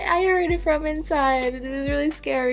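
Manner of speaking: high, teary mock sobbing; hammed-up tearful voice telling the news crew what she heard; imitated crying. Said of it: "I'm crying, I'm like".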